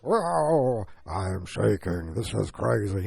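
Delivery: slow, strained mock actor voice; Vin Diesel being shaken. Actor on a chair pretending to be shaken, slow mock voice. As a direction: drag out the words like a shaken stiff action star.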